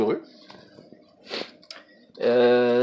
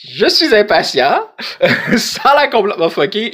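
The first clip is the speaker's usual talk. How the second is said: excited; 8 semitones higher, 5 dB louder than usual. Real, not acted. Excited about the cat game.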